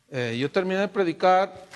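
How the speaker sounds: ordinary talk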